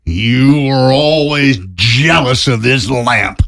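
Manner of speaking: slow, high furious scolding; angry. Acted. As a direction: snap at her over the lamp. Acted scene, dad scolding.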